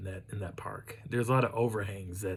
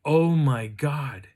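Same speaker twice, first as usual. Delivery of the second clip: slow, loud, drawn-out amazement; astonished and higher, slowly savoured; awestruck reaction; 5 semitones higher, 9 dB louder, much slower than usual. Retold or imitated. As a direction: imitate her stunned awe, stretching every word. Wife sees the photo, retold.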